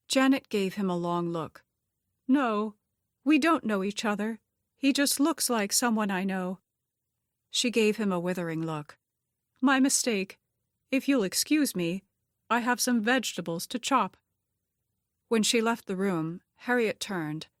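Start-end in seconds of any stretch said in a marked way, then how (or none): 2.3-6.6 higher character voice, covering up; high-voiced woman smoothing over a slip; guarded kitchen helper. A narrator's reading. Janet covering for Gabe. 9.6-14.2 higher voice, politely pointed; high-voiced helper making a cool exit; withering kitchen helper. A narrator's reading. Janet excusing herself curtly.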